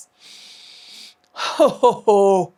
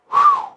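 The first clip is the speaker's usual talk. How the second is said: exertion whew; 6 dB louder than usual. Real, not acted. Exhale while stretching.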